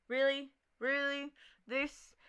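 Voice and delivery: can't believe this is happening; exasperated disbelief. Acted. Tagger caught by cops, incredulous and exasperated.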